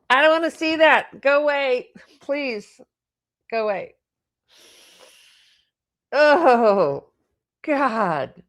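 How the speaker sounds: high, distressed plea, fading then surging into a groan; recoil, pleading for it to stop; real emotional recoil. Real, not acted. Card reader recoiling from what she sees, distressed. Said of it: "strong emotional and physical reaction like that".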